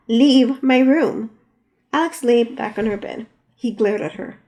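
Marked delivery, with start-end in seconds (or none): 0.1-1.3 loud, very slow command; angry order. Acted. Danielle ordering Alex out of her room, loud and slow. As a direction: spell out each word like a final warning.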